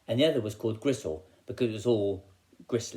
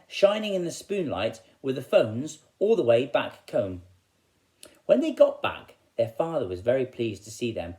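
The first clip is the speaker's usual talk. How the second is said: plain reading voice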